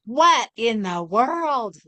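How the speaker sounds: baffled and amazed; astonished. Retold or imitated. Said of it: "She's like".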